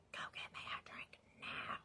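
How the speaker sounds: urgent whispered order; retold whisper. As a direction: whisper the demand. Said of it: "Why are you whispering?".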